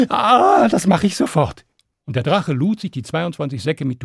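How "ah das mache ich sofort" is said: higher, louder eager reply; eager dragon. Acted. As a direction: agree at once, relieved.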